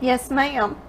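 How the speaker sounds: usual speaking voice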